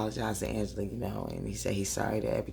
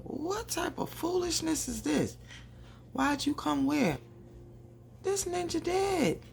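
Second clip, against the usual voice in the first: high, outraged disbelief; what is this foolishness, high and sharp; incredulous. Real, not acted. Vlogger reacts to the dead brother appearing, high incredulous outrage.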